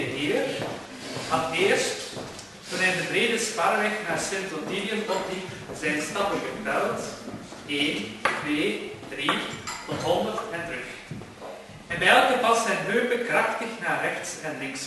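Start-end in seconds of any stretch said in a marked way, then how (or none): none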